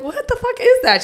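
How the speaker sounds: mock-horrified British accent reaction; shocked outburst. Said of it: "I'm like".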